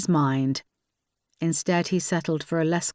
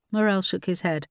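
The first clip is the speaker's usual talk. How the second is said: usual speaking voice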